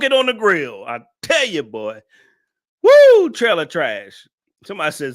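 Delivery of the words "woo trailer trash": slow, much louder whooping jeer; loud mocking whoop; loud hoot. Real, not acted. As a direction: holler it at her like a heckler. Roasting viewer loudly.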